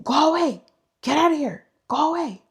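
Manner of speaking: shouting the dog away. Retold or imitated. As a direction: yell out the door to scare it off. Grandpa shouting to scare dog away.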